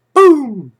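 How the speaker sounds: high, punchy mock boom; celebrating the market going up; playful boom. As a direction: throw out a big comic boom. Punchline on rally.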